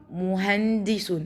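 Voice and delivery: مهندس said slowly, each syllable drawn out; slowed demo. Shown as a demonstration. Teacher showing first graders how مهندس splits. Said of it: "نبص على الكلمة كويس".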